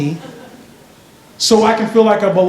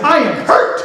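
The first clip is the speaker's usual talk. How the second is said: higher mock-indignant girl; girl's hurt protest; 7 semitones higher than usual. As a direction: protest like a wounded schoolgirl. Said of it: "The girl was like".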